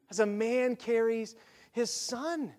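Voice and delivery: slow, soft, with a pause before "his son"; savouring the verse. Acted. Preacher dwelling on the beautiful picture, slow savouring repeat. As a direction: linger on "As a man carries", then set "his son" apart.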